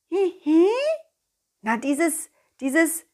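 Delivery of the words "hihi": high giggle. Acted. Ball character giggles.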